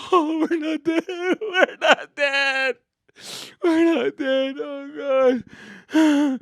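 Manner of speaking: high, giddy relief; relieved laughing. Retold or imitated. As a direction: laugh it out in disbelief. Relieved after ride.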